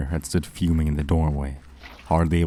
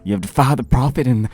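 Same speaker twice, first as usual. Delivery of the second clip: much higher and louder furious threat; enraged; 6 semitones higher, 6 dB louder than usual. A narrator's reading. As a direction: spit the curse in blind rage.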